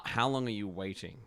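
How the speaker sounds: ordinary delivery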